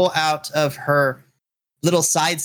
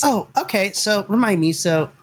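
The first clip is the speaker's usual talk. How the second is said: put-on higher, eager little girl asking names; ecstatic girl; 7 semitones higher than usual. Young girl to the party, mildly ecstatic. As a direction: bubble over, a bit higher. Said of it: "gets ecstatic".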